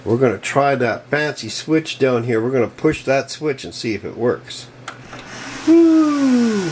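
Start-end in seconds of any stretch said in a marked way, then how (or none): 5.7-6.7 high, loud drawn-out "woo"; excited whoop. Real, not acted. Builder testing the power switch as the fan starts, excited whoop. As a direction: whoop that slides gently down.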